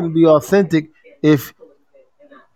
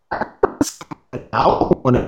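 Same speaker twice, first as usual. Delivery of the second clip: garbled bad connection; phone audio cutting out; glitchy phone line; 4 semitones lower, much slower than usual. Real, not acted. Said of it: "your phone messed up".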